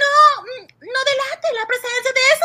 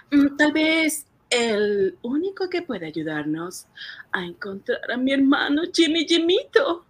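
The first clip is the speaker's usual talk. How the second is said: whispering; 10 semitones higher, 7 dB quieter than usual. Acted. Dreamy confession about hero.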